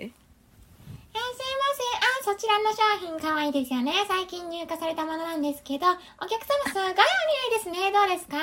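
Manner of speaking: high, fast eager sales talk; pushy shop clerk. Pretend. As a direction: gush at the customer like a clerk. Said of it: "話しかけてみて".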